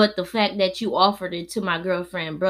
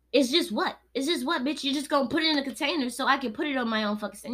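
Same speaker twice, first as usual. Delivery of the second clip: retold angry comeback; 6 semitones higher, faster than usual.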